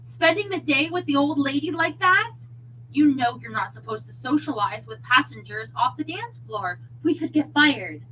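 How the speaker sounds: agitated telling-off. A narrator's reading. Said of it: "voices raised in Cookie's cabin".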